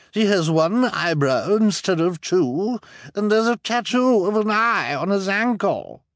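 high, loud accusation; accusing woman. Acted. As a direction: point out the proof smugly. Mrs Morrow accuses.

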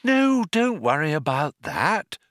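high sing-song comfort; musical, humouring reassurance; lilting. A narrator's reading. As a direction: keep up the bright lilt. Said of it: "the lilt continued".

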